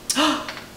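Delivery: soft, sudden intake of breath; delighted gasp. Acted. Cat sees himself turned yellow, soft gasp of wonder. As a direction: gasp at the new color.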